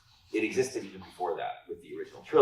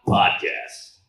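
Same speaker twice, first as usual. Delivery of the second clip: announcer voice; 9 dB louder than usual. Acted. Takes over the announcer bit.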